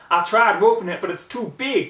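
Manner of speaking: a frantic country mare calling out; high pitched desperate outcry; crying out as a farm pony. A narrator's reading. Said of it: "cried the stupid orange hayseed".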